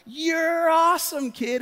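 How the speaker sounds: high, drawn-out gushing cheer; freaking out. Retold or imitated. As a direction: re-enact a dad thrilled by a big hit. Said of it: "I'm freaking out, like".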